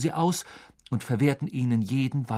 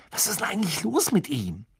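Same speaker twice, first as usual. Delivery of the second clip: Hermine, worried; 7 semitones higher than usual. Acted.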